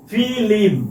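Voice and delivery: ফিল্ম said as filim, an i slipped between l and m; folk pronunciation. Shown as a demonstration. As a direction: break ফিল্ম into fi-lim. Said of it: "এইভাবে লিখি".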